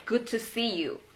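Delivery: 'Good to see you' with a full 'you'; careful unreduced 'Good to see you'; full form. Shown as a demonstration. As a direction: sound each word of 'Good to see you'. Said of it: "To say".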